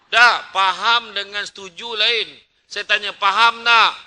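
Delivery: loud, insistent questioning of the crowd; booming preacher repeating the question; exasperated demand. Real, not acted. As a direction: press them hard for an answer. Preacher pressing the audience.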